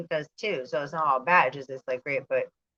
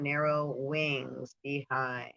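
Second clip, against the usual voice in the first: ordinary delivery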